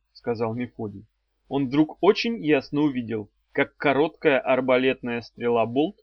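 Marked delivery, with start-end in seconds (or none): none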